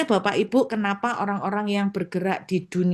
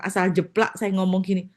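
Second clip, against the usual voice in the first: ordinary talk, nothing marked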